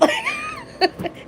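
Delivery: shrieking burst of laughter. Real, not acted.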